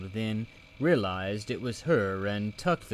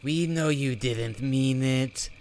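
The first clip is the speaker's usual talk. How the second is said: slow, reassuring creature voice; Bobble voice; 4 semitones higher, 4 dB louder than usual. Acted. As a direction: comfort her gently and slowly. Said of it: "said Bobble".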